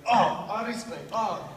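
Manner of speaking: high, loud "oh" of alarm; mock startled cry. Roommate's feet in his face, mock startled outcry. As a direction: exclaim as if caught off guard.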